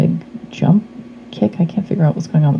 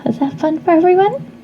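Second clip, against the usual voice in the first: put-on high, light little girl voice; 13 semitones higher than usual. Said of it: "talk like a little girl".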